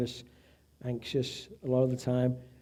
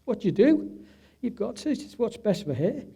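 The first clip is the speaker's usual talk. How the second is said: helpless; 7 semitones higher than usual. Retold or imitated. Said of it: "I'm like".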